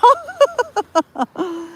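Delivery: high burst of giggling; a sudden squeaky laugh. Real, not acted. Laughs at her own remark.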